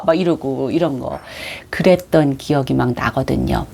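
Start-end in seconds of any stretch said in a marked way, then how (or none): none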